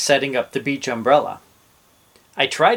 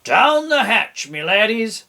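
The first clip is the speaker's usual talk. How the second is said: bang the table and roar the toast; hammed-up pirate toast; pirate yell; 8 semitones higher than usual. A narrator's reading. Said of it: "pounded his fist ... and yelled".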